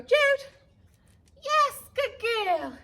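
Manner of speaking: high, loud, stretched-out praise; slow, high happy praise for a good dog; excited dog praise. Real, not acted. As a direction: gush over the dog.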